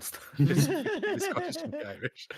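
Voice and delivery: loud, high burst of laughter through the words; real laughing. Real, not acted. Game master mocks own failed Scottish accent, really laughing. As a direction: crack up helplessly while talking.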